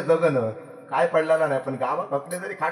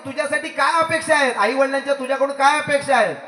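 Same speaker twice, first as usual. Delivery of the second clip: angry teacher; 9 semitones higher, 3 dB louder than usual. Retold or imitated. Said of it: "चिडलो".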